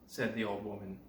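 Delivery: usual speaking voice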